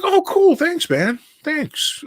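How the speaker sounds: high, bright burst of surprised thanks; surprised delight. Retold or imitated. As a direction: caught off guard and flattered. Fan recognizes him unexpectedly.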